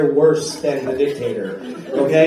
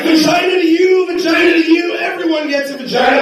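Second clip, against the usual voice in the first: high, loud, hyped giveaway shout; Oprah; 13 semitones higher, 5 dB louder than usual. Retold or imitated. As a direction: hand out prizes to a whole crowd. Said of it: "I'm like Oprah".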